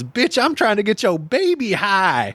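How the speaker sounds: high, jeering stoner voice; mock stoner heckle. Friend retelling a high school prank, jeering. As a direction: taunt the mother from the car. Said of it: "blows out a huge rip of weed out the window and goes".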